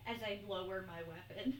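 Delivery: ordinary talk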